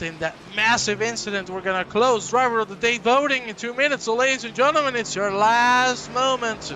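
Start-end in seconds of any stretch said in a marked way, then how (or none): 1.5-6.7 louder, higher last-call announcement; announcer call. Acted. Commentator urging viewers to vote, loud announcer call. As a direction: urge viewers to vote now.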